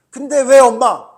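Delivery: high, very loud retort to mom; acted-out angry protest; angry outburst, imitated. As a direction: fire back like an indignant kid. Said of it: "화를 냈단 말이야".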